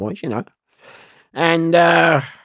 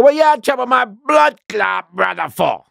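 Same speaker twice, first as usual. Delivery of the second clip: louder, higher, slow Jamaican patois threat; 11 semitones higher, 6 dB louder, slower than usual. Retold or imitated. Gang member accuses him in Jamaican patois. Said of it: "he go".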